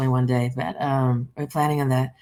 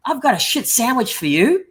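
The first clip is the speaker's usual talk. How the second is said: higher-pitched mock confrontation; raised, in-your-face mimicry; imitated confrontation; 7 semitones higher, 4 dB louder than usual. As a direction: retell her storming up with a complaint. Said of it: "she came storming up to me".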